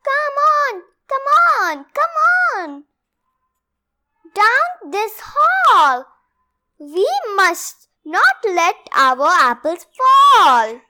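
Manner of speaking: high, loud, hurried urging; rally everyone to run; urgent calling. Acted. Reading aloud, the animals urge each other to flee.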